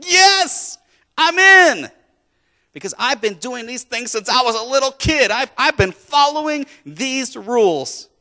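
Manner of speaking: loud, high eager yes; excited. Retold or imitated. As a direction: burst out with eager joy. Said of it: "really excited 'cause he's like".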